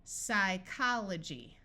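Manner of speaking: "psychology" said slowly, silent P, each syllable clear; teacher stretching "psychology" so the S start is heard; slow demo. Shown as a demonstration. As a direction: sound out "psychology" slowly for a young reader. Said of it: "P S says s".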